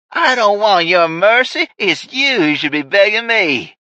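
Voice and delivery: high, loud, slow sneer; scornful villain. Acted. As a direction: spit the refusal back at him. Ray scorns the offer.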